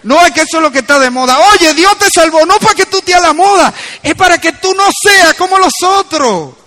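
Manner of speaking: loud, high pulpit exhortation; forceful preaching. Real, not acted. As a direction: drive it home to the congregation.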